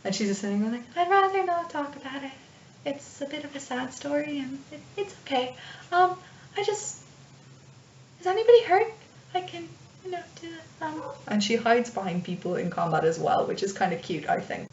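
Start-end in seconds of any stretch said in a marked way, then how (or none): 1.0-7.0 shy away in a small high voice; timid, reluctant little voice; timid healer. Acted. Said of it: "no one is that timid". 8.2-11.0 timid and hesitant, trailing away; timid healer. Acted. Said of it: "no one is that timid".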